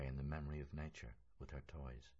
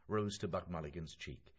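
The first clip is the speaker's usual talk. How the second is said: usual speaking voice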